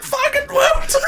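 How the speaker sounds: very high-pitched, laughing disbelief; astonished disbelief. Retold or imitated. Fan finds a surprise third season, high astonished squeal. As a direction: squeak it in comic shock.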